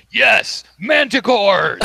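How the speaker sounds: loud, high rallying shout; hammed-up triumphant holler; acted monster yell. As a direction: cheer for your own side at full voice. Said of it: "also yells".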